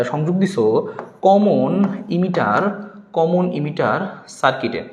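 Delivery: very slow, word by word for writing down; slow dictation. Shown as a demonstration. Teacher dictating exam answer words to students, slowly. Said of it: "এখানে কথাগুলো লিখে দিচ্ছি".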